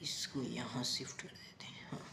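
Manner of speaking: hushed off-mic aside; whispered aside. Real, not acted. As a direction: whisper to yourself while fixing the board. Aside while arranging.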